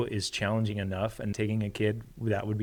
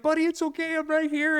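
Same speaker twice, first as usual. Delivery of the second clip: comforting dad. Retold or imitated. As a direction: soothe a scared child in your arms. Dad hugging crying son.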